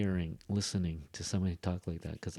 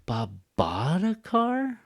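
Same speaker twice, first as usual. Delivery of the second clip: slow, "bought" soaring high and held; astonished drawn-out echo question on "bought"; exaggerated stress on BOUGHT; 9 semitones higher, 4 dB louder, slower than usual. Shown as a demonstration. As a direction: stretch "bought" high in one breath. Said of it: "with one breath".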